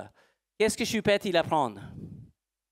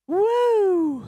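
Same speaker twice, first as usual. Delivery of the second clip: high, loud drawn-out "woo"; loud whoop; 6 dB louder than usual. Real, not acted. Teacher demonstrating with a horse, high loud whoop. Whooping while tossing the rope.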